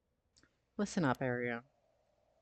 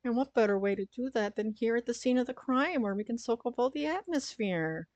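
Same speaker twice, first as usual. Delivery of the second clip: high bubbly airhead voice; chirpy ditzy partner, pitched up; bimbo voice; 8 semitones higher than usual. Acted. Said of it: "a bimbo voice for Ario".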